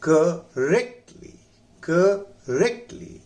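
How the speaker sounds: करेक्टली drawn out, slow, rising; slow model pronunciation. Shown as a demonstration. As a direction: spell out करेक्टली slowly for students. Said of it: "उसके बाद है". (धीमा आदर्श उच्चारण)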